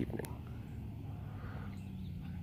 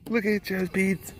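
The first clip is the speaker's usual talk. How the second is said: put-on high cooing baby talk; 9 semitones higher, 8 dB quieter than usual. Cooing at the animal's Sharpies in high baby talk. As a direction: coo the words up high at the animal.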